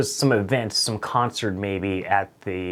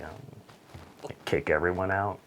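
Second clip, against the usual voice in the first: soft unvoiced puzzled whisper; whispered, baffled question; whispered doubt; 4 semitones lower, 9 dB quieter, slower than usual. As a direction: hush it, unsure. Whispered unsure reply.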